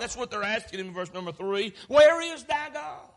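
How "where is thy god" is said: high, loud, drawn-out question; stretched, raised mocking demand; taunting challenge. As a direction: call it out like a jeering crowd. Crowd's taunt voiced by preacher.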